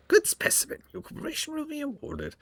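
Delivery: high, eerie surgeon creature voice; creepy homunculus voice. Acted. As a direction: voice a gloating flesh-crafter, high and strange. Homunculus voice continues.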